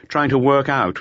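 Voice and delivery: ordinary delivery, nothing marked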